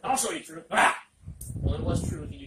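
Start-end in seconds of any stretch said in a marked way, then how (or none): 0.0-0.7 louder, play-acted threat; mock threat. Acting out hurling truth at someone. 0.7-1.1 sudden loud play-acted "ah"; short explosive yell, as if hurling something; hammed-up throwing yell; mock attack yell. Mimes throwing a Bible at someone.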